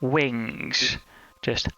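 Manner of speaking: slow, hushed game master narration; slow scene setting. Acted. GM sets the dragon scene.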